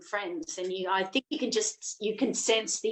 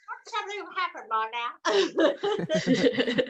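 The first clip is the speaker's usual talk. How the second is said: mock fretful; 6 semitones higher, faster than usual. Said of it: "she goes".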